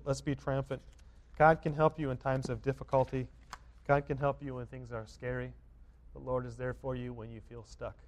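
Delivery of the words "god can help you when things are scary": normal talking voice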